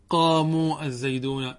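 قاموا slow with a long uu, apart from الزيدون; rejected plural waw. Shown as a demonstration. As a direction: hold the uu of قاموا, then say الزيدون slowly. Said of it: "مرفوض". («قاموا الزيدون» ببطء مع مدّ الواو)

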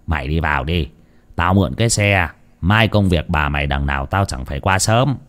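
low, softened parting instructions; lightly voiced weary elder; tired uncle. A narrator's reading. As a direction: send him inside, low and matter-of-fact. Uncle drops nephew at gate.

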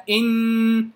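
إن slowly with a long held nasal hum; stopping on shadda. Shown as a demonstration. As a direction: stop on إن and hold the doubled noon. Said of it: "لما أقف على". (الوقف على إن بغنة طويلة)

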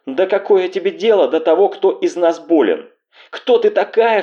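high-pitched angry outburst; breaking into shouting. A narrator's reading. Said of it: "Вдруг сорвалась Анна на крик". (срываясь на злой высокий крик)

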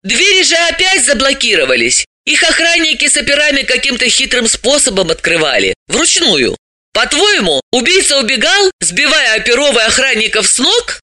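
high-pitched, insistent explainer; high character voice, objecting; animated female character, arguing. Acted.

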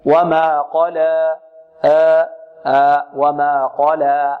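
slow وما قال as "wa ma qalaa", long open final a; open alif, no tilting. Shown as a demonstration. Teacher modelling the verse ending, open held alif. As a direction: sustain آ purely, without hamza. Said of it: "بدون تقليل".

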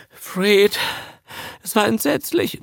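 very high, plaintive whimper; whimpering Darling. A narrator's reading. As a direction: wail it in lingering horror. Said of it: "wimmerte Darling".

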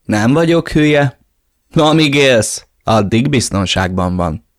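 cocky thug. Acted. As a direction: shrug off the threat with a sneer. Reggie answers the threat. (gúnyos, magasabb hangon visszavágva)

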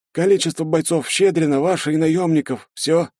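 put-on a scared young sergeant answering; frightened soldier. A narrator's reading. (испуганный солдат, высоким голосом)

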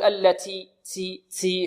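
"التي" with the t hissing into s, like "tsi"; overdone aspiration. Shown as a demonstration. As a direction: exaggerate the breath on t in "التي" until it whistles. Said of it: "يبالغون في الهمس". (مبالغة في همس التاء في "التي" حتى تصير كالسين)

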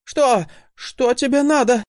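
nervous, high, trying to sound sure; a scared boy forcing confidence; voice trembling. A narrator's reading. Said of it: "голос его всё же дрогнул".

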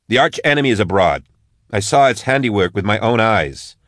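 a firm lawwoman standing her ground; Marshal Max. Acted. Max voiced, raised pitch.

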